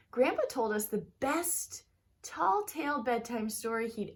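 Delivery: lively, expressive storybook reading; reading to children. Acted. Teacher reading a picture book aloud to her students, lively storybook voice. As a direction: read it like story time for a class.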